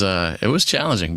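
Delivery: usual speaking voice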